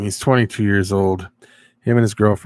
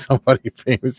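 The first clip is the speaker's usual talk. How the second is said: usual speaking voice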